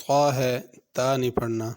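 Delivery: ordinary talk, nothing marked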